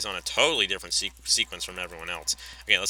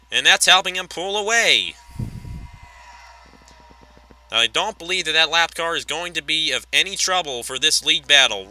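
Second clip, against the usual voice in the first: raised, driving race commentary; live race play-by-play; 8 semitones higher, 8 dB louder than usual. Pretend. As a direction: call the lead battle like a track announcer. Calls the final laps.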